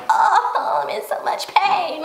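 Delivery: high, hammed-up groan of pain; mock the sufferer with a high moaning whine; fake agony. Driver with a scratched car claiming agony, mock wailing. Mocks exaggerated injury over a scratch.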